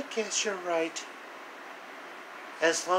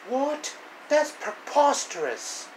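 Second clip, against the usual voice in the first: high, indignant gasp of disbelief; outraged disbelief; 10 semitones higher than usual. Acted. Villain hearing the prisoners escaped, high indignant outrage. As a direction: act scandalised at the news.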